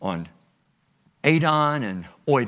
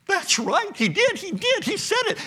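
much higher, voiced as the women, eager agreement; the women at the tomb, suddenly remembering; excited ladies. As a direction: chirp it like the women just remembered.

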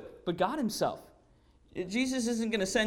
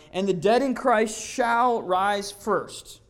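very slow, louder, each word weighed; solemn scripture; 6 dB louder, much slower than usual. Acted. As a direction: let the promise land word by word. Slow solemn verse reading.

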